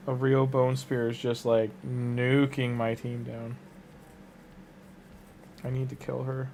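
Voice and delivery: ordinary talk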